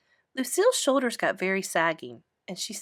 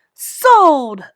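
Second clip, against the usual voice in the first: excited; 7 semitones higher, 14 dB louder than usual. Acted. Junie eagerly accepts the prizes.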